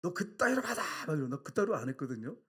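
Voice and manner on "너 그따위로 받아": imitating a furious superior's shout; loud, high-pitched mock reprimand; angry yelling. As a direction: bawl it like an irate army superior. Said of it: "소리를 막, 막 지르면서".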